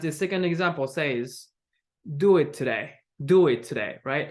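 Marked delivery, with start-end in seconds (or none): 2.0-4.0 firm command, "today" goes down; falling intonation. Shown as a demonstration. Teacher models a command for learners, falling intonation. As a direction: drop the pitch at the end of "today". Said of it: "we have falling intonation".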